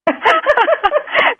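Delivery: high-pitched laugh. Real, not acted. Recalling [name]'s jump, high laugh.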